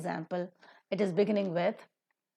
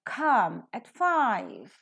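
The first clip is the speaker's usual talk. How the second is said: very slow, come aspirated, five stressed, at weak; slow model with weak at; 3 semitones higher, 4 dB louder, much slower than usual. Shown as a demonstration. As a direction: spell it out slowly, at reduced. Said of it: "come is pronounced, is stressed".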